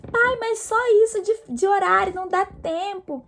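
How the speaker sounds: put-on high, whiny teenage complaint to dad; retold frustrated whine, pitched well up; mock angry protest. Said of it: "eu ficava com raiva e falava".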